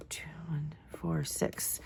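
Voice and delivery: whispering numbers under her breath; whispered counting. Real, not acted. Crafter working out measurements, whispering the numbers to herself. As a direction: count it quietly to yourself.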